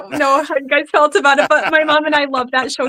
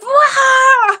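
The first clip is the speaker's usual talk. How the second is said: wild high cartoon yell "Wah!"; genie; 13 semitones higher, 7 dB louder than usual. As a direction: burst out with a manic genie whoop. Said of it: "He's like".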